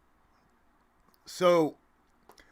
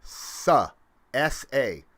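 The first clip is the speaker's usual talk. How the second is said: ordinary delivery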